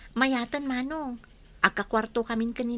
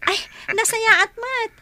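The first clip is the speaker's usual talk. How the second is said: delighted surprise; 9 semitones higher, 9 dB louder than usual. Acted. Surprised happy phone call.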